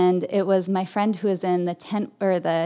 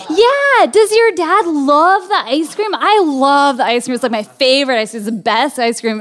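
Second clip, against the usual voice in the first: eager gushing; 5 semitones higher, 6 dB louder than usual. Retold or imitated. Eager kid keeps gushing.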